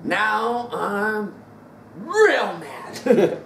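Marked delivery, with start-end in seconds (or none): 2.0-3.5 laughing. Real, not acted. Laughing at own line.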